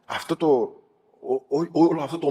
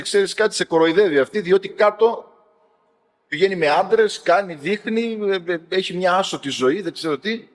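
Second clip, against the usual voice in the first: higher-pitched, lightly acted gossip; imitated tale-bearers; 5 semitones higher, 3 dB louder than usual. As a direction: report the scandal like a busybody telling on someone. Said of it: "πήγανε πάλι κάποιοι ματατοφόροι".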